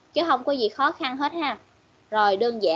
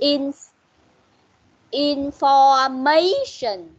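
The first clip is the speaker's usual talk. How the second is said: information very slow, "ma" leaned on hard; stressed syllable demo; 4 dB louder, much slower than usual. Shown as a demonstration. Teacher prompting student to hear stress, very slow information with strong "ma". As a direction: drag out information and punch "ma" louder. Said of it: "Rồi, nhấn ở đâu?".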